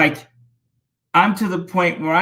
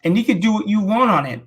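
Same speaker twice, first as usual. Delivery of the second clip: ordinary talk, nothing marked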